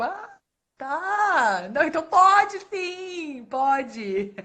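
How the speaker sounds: caught-out, flustered backtrack; sudden realization. Retold or imitated. Librarian realizing the student is in high school, flustered. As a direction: quickly take it back, embarrassed.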